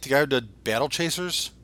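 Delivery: usual speaking voice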